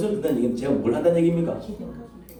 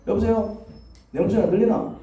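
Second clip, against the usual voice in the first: higher, questioning hello; acted phone caller; 6 semitones higher than usual. Caller asking if he is heard, raised questioning voice. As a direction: check whether the other end can hear you.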